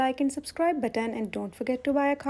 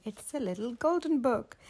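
usual speaking voice

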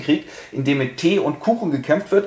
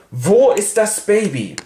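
higher, slow, a lightly voiced imperious aunt; stern aunt; 8 semitones higher, 4 dB louder, slower than usual. Lady Bracknell demands of the governess where the baby is, slow and imperious. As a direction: lightly voice a grand old lady demanding answers.

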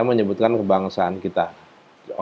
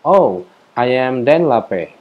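slow model reply; 3 semitones higher, much slower than usual. Shown as a demonstration.

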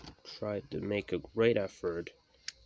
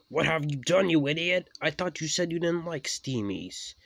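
high, loud outburst; raised, indignant voice; angry scolding; 8 semitones higher, 7 dB louder than usual. A narrator's reading. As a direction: tear into the other engine loudly. Engines quarrel after crash.

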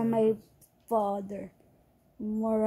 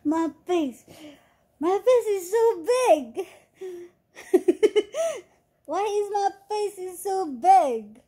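laughing; 10 semitones higher, 6 dB louder than usual. Real, not acted. Laughs at own face on camera.

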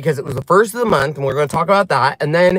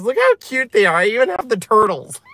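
ordinary talk, nothing marked

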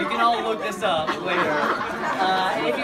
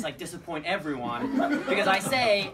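ordinary talk

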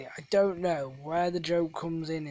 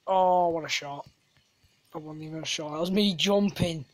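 ordinary delivery

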